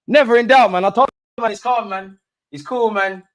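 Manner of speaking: giddy fan relief after the whistle; relieved excitement. Real, not acted. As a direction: let the relief burst out, then settle. Relief after late goal.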